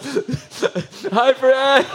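high, laughing hi back; laughing hello. Retold or imitated. Pastor caught weeping answers Fred, laughing high hello. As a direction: greet him through a laugh.

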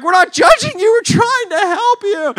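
very high, loud protest; protesting rescuers. Acted. Rescuers to angry trapped man, high shouted protest. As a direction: plead back at a yell, drawn out. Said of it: "And they're like".

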